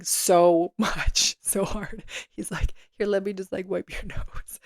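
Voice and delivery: amused, words dissolving into laughter; soft voice giggling through the story; laughing retelling. Real, not acted. Laughing while retelling own sobbing story.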